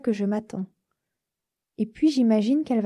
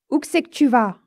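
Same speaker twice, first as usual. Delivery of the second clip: gruff driver; 2 semitones higher, 6 dB louder than usual. Acted. Rough driver asks where going.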